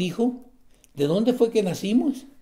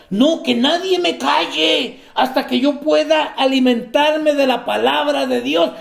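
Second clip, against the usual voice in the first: pleading to be fed the word, raised and louder; eager believer; 8 semitones higher, 5 dB louder than usual. Pretend. Preacher voicing the hungry believer, eager and loud. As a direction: insist no one silence you until fed. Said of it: "Así deberíamos de ser nosotros".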